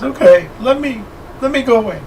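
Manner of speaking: a weepy woman begging to leave; crying lady. Acted. Upset lady pleads to leave the party, high and tearful. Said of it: "she just starts crying".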